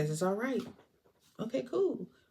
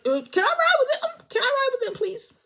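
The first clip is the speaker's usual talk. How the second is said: put-on much higher little-kid plea; high child's voice, pleading please; begging kid; 4 dB louder than usual. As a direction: whine it like a small boy begging to tag along. Kid DJ begs to ride.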